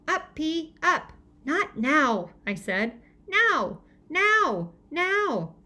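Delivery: put-on toddler voice. A narrator's reading. Toddler Tootsie demanding.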